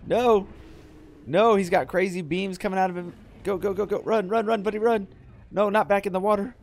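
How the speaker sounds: high, loud urgent shouts; real panic, shouting commands; frantic yelling. Real, not acted. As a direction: yell at the buddy to run. Boss beams, frantic yelling.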